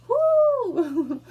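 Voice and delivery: high, loud whoop breaking into laughter; loud woo. Real, not acted. Vlogger releasing anger after an outburst, whooping. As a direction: blow off steam with a woo.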